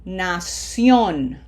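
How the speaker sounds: Nación with a strong, held CIÓN; stress on last syllable. Shown as a demonstration. Teacher modelling for students, Nación stressed on CIÓN. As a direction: lean on the end of Nación. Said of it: "red syllable is what has pronunciation".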